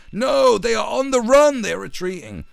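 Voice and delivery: hammed-up propagandist in denial; mock regime spokesman. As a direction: insist shrilly against all evidence. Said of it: "He's like".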